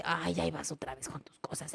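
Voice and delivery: put-on soft, weary scorn for the boy's feelings; dismissive parent. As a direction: sigh it off as nonsense. Parent dismisses a sad boy.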